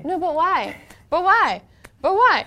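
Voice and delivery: put-on high, loud whiny child; nagging little kid asking why; pestering child. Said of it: "that five-year-old kid that's like".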